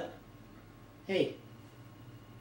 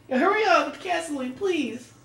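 high mock urging; 7 semitones higher than usual. Urging partner nervously.

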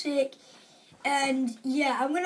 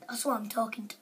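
whisper; 3 semitones lower, 8 dB quieter, much faster than usual. Real, not acted. Sheepish whispered reply.

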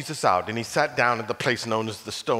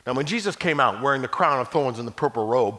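plain reading voice

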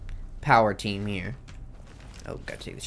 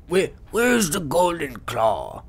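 put-on old man voice; 4 semitones higher, slower than usual. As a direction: play a greedy old man. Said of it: "There's my old man voice".

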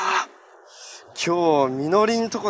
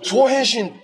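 transformation shout; 7 semitones higher, 4 dB louder, much slower than usual. Acted. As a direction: punch out the call high and loud. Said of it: "勢いつけるために何か言いたいんですよ".

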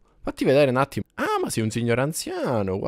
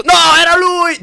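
surprised shout; 10 dB louder than usual. Real, not acted. Fake death fails, killer appears.

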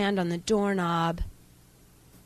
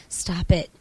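each one louder, up to a scream; scream, building; 6 dB louder, slower than usual. Acted. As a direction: beg frantically for it to stop. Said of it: "cover your ears with your hands and scream".

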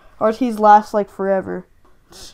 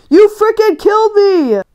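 furious yell, much higher and louder; angry yell; 11 semitones higher, 7 dB louder than usual. Real, not acted. Gamer raging at the enemy who killed him, much higher and louder. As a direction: rage at the player who killed you. Just died in game.

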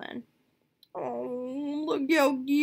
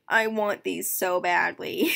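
normal talking voice

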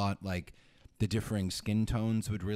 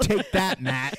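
mock triumph; 9 semitones higher, 8 dB louder, much slower than usual. Mock curse on absent brother.

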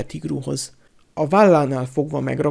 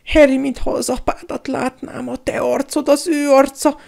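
high-pitched, wheedling appeal; cringing high voice begging for favour; fawning coward; 11 semitones higher, 4 dB louder than usual. A narrator's reading. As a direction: plead ingratiatingly, voice pitched way up. Pettigrew trembles, appeals to Harry.

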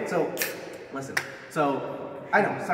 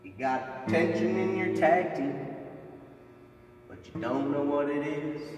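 wrestling promo. Acted.

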